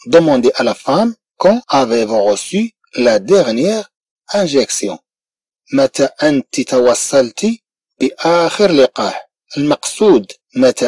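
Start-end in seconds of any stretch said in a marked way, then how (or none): 0.1-5.0 slow model sentence. Shown as a demonstration. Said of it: "المقصود اسأل المرأة".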